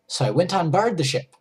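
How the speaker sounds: normal talking voice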